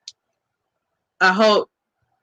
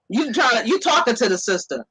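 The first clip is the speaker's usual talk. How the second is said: normal talking voice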